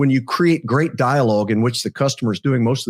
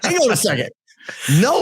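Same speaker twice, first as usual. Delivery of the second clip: startled date; 9 semitones higher than usual. Acted. Acts out date's startled refusal.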